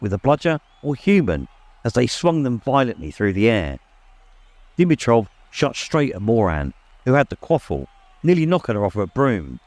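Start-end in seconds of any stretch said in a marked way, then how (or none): none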